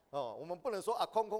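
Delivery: ordinary delivery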